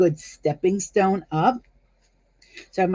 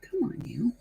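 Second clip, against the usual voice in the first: whispered coaxing to the thread; breathy little whisper, urging; 5 semitones higher, 10 dB quieter than usual. Real, not acted.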